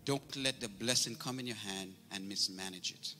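usual speaking voice

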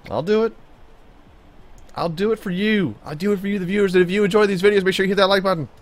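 high-pitched hype for the viewers; hammed-up excitement. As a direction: pitch shoots up into a loud rallying call. Hyped-up plea to viewers.

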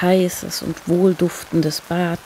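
usual speaking voice

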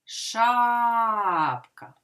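Шапка with a long held ША; stress on first syllable. Shown as a demonstration. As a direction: hold ША, then a short falling ка in Шапка. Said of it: "так, тому наголошений".

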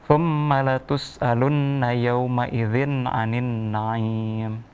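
slow, measured tartil recitation; Quran recitation. Shown as a demonstration. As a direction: recite slowly for a child to repeat. Teacher recites for pupil.

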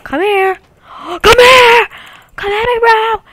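real gamer hype yell; excited taunting yell. Real, not acted. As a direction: shout at the enemy, pumped up. Chasing enemy in fight.

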